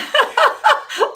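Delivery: bursting into high laughter; real laughter. Real, not acted. Host laughing at the lost first take, loud real laughter.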